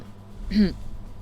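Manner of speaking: clears throat hard; throat clearing. Real, not acted. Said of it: "I'm choking on mucus".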